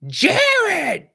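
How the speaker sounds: high, loud shout of a name across the gym; coach's yell. Retold or imitated. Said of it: "And he's like".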